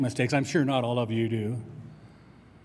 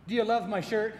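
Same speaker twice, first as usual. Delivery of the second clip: playful mock question; 7 semitones higher, 3 dB quieter than usual. Mock question about his shirt.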